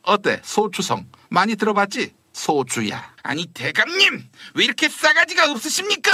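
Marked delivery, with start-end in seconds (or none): none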